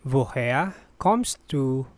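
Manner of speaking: careful teaching pace. Shown as a demonstration. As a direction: ask it piece by piece. Teacher repeats slowly for learners.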